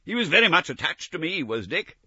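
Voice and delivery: fast, high, affectionate; gushing about an old friend; eager fond Scrooge. Acted. Scrooge to the ghost about Dick, fondly eager.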